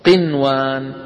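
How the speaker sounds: قنوان with the nun kept clear, not merged into the waw. Shown as a demonstration. Teacher showing the nun pronounced clearly in قنوان. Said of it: "شايفين النون كيف مظهرة".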